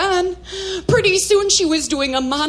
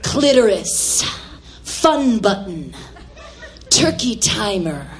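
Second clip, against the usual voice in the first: mock daughter's monologue; 9 semitones lower than usual. Mother quoting daughter's stage monologue, deep mock recital. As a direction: intone each name separately, ever lower. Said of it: "She started doing a monologue".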